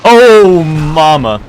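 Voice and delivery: startled high yelp; jolted by a sudden scare; scared horror game streamer. Real, not acted.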